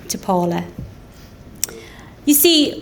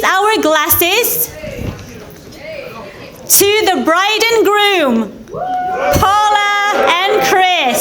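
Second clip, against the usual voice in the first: wedding toast speech; 9 semitones higher than usual. Real, not acted. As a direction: call the room to raise glasses. Best friend raising toast.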